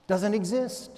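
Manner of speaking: ordinary talk, nothing marked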